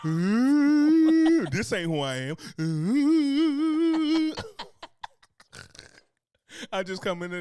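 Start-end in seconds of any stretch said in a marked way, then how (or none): 0.1-1.4 high, loud fake wail; mock sob. Mock sobbing at friend's remark. 2.6-4.3 high, loud fake wail; mock sob. Mock sobbing through laughter.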